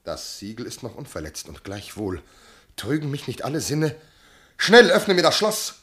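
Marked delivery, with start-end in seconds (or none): 4.6-5.8 urgent command. Acted. Husband orders servant urgently.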